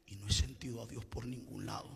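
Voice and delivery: hushed confession after the shouting; whisper. Acted. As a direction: drop to a bare whisper. Sudden hush after shouting.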